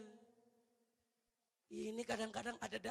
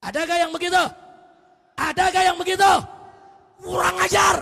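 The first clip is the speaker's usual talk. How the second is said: loud, high indignant shout; full-force preacher in outrage; fiery preaching; 6 semitones higher, 22 dB louder than usual. Real, not acted. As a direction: roar the rebuke at the congregation.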